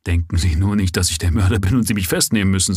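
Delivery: plain reading voice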